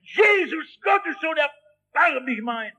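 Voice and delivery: high crying shout, fading toward the end; bellowing. Acted. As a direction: beggar crying out over the crowd. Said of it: "Und er".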